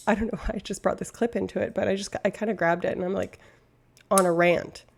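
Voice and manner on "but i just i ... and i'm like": ordinary talk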